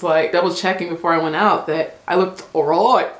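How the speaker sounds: normal talking voice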